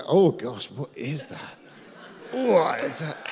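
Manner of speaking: rising, startled disgust; mock shock. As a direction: react like you just found something gross in the drain. Acted shock at the drain.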